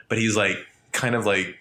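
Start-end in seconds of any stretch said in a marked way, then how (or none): none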